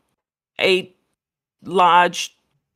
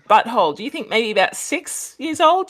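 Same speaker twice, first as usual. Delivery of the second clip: ordinary delivery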